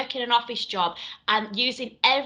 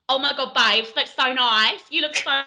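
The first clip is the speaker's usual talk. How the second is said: hammed-up Essex babe; Essex accent; 4 dB louder than usual. Shown as a demonstration. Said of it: "my Essex accent's all right".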